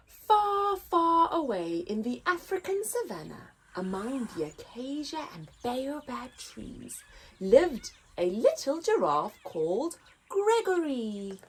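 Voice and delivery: lively picture book read-aloud; story narration. A narrator's reading. Narrator reading to children, lively.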